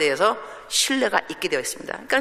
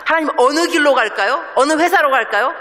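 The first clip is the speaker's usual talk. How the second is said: higher and louder, pleading to God for direction; anxious pleading; 6 semitones higher, 5 dB louder than usual. Retold or imitated. A believer fretting as she begs God which road to take.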